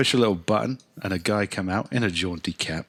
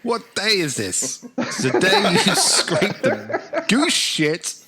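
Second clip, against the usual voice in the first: high, loud hammed-up calling out; Dickensian gentleman; 12 semitones higher, 4 dB louder, slower than usual. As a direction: hail the street boy theatrically, drawn out. Acts Dickensian customer hailing boy.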